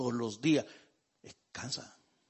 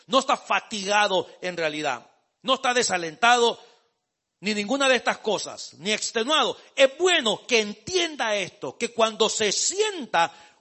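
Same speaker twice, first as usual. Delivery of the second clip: louder, higher, slow emphatic preaching; fervent preaching; 4 semitones higher, 9 dB louder than usual. Acted. Pastor urging the tired congregation, loud and slow. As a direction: press each phrase on the congregation.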